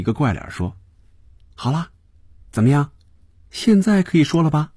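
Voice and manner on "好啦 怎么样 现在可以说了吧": high-pitched, cheeky questioning; playful prodding. A narrator's reading. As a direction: prod your boss playfully in a high voice. 菲比做怪脸后得意追问. (调皮地追问，声调高)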